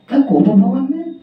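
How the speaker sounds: high, rising disbelief; a king who can't believe his ears; shocked question. Acted. King hearing the queen sulks, in high disbelief.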